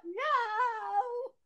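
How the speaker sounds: high, drawn-out mock whimper; drama queen whine. Daughter asked if she can get up, mock whine. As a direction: whine it helplessly, imitating the daughter. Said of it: "she's the worst drama queen ever".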